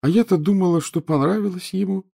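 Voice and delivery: put-on high, whining ghost girl; a pitiful lovesick ghost girl; plaintive ghost girl. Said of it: "жалобно продолжала Миртл".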